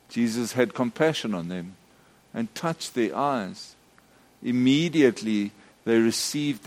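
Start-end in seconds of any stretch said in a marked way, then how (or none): none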